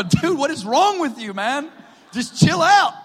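high, loud exasperated yell, rising to a shout; exasperated shout at friend. Retold or imitated. Shouting at his creepy friend Zach to chill out, loud and exasperated. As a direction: yell at him to knock it off, half laughing.

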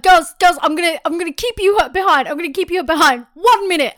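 high, loud scolding teacher; stern teacher voice. Retold or imitated. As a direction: scold the class like a strict teacher.

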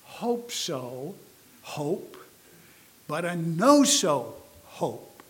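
"hope so" lightly questioning, "know so" slow and leaned on; contrast stress. Shown as a demonstration. "know" stressed slowly and firmly against a light rising "hope so". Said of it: "It's not a".

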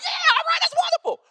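shrieking with joy, very high; gushing falsetto celebration; ecstatic fan. Retold or imitated. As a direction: squeal like a thrilled fan. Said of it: "They're gonna be high-fiving".

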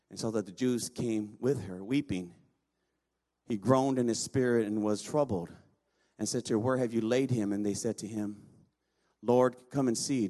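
low, measured reading of the Bible passage; scripture reading. Acted. Preacher reading the Lazarus passage to the congregation, measured and low. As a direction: read the Gospel aloud, evenly and low. Reads the Gospel passage aloud.

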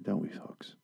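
hushed confiding whisper. Acted. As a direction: whisper it to the audience like a secret. Hushed aside to viewers.

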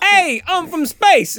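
high, loud, drawn-out announcement; hammed-up high yell; mock hero shout. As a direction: holler it slowly as a cartoon hero. Acted meeting of two space heroes.

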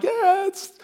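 high, drawn-out fake agreement; hammed-up awkward yeah; mock enthusiasm. Preacher fakes approval of partying stories, high and slow.

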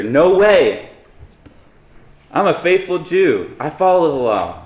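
acted-out insistent objection; emphatic refusal. Acting out Peter's indignant refusal.

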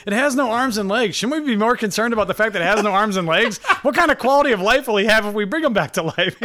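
lightly voiced worried boss; a hint of Michael Scott fretting; imitating Michael Scott. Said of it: "Michael Scott's like".

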